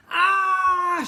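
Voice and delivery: high, loud yelp of alarm; yell. A narrator's reading. Jemima yelling as she bounces down the corridor. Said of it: "she yelled".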